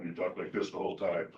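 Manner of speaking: dropped pitch, low and soft; mock authoritarian. Shown as a demonstration. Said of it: "Boy, am I an authoritarian".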